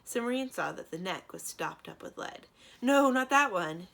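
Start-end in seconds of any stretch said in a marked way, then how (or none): 2.8-3.9 high, loud hurried warning; said quickly. A narrator's reading. Cimmerian stops Thranduil opening the jar, quick loud warning. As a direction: stop him fast before he opens it. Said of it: "she said quickly".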